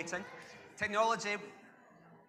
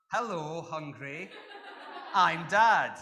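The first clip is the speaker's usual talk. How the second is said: dad joke delivery; 7 dB louder, slower than usual. Retold or imitated. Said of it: "What does Dad say?".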